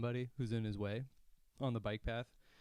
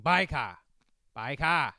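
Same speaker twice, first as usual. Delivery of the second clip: repeated falling British warning call; old man British accent; 3 semitones higher, 8 dB louder, slower than usual. Retold or imitated. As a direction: yell it in a British accent, dropping the R. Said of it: "in his old man British accent".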